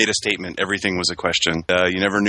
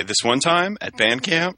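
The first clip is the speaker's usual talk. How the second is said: slow, higher mock band camp line; band camp quote; 6 semitones higher, slower than usual. Teasing a friend with the band camp line, slow and high. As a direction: drag out "This one time at band camp" as the famous movie quote. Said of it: "I thought for sure you were gonna say".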